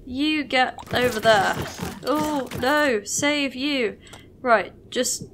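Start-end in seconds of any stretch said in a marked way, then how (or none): none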